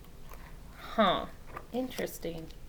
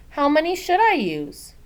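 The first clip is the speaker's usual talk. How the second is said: put-on eager child; 8 semitones higher, 6 dB louder than usual. Child asks again.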